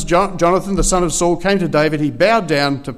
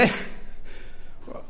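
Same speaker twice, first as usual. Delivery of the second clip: stifled laugh, low in level; real laughing; 11 dB quieter than usual. Real, not acted. Preacher tickled by his own story of the fleeing nurse, stifled laughing. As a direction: laugh helplessly under your breath. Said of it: "I don't know why I'm laughing".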